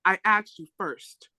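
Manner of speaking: normal talking voice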